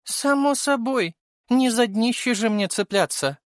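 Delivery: high female character voice, matter-of-course; self-assured young woman. A narrator's reading. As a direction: toss it off high and cocky. Venera answers in her character voice.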